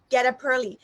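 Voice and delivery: "get up early" joined up as "geta-purly"; linked words. Shown as a demonstration. Teacher repeating the model, "get up early" with linking. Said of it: "we have to make a link together".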